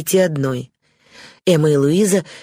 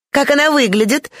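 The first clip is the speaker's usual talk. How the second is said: put-on high-pitched, eager girlish question; sisters asking together; 11 semitones higher than usual. A narrator's reading. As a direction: pipe up high like curious sisters. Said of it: "спросили сёстры Эштон в один голос". (высокие голоса любопытных сестёр)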